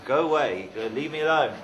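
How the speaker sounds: mild angry dismissal. Acted. Man throws the snail away, mildly angry shout. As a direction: send them packing, lightly acted.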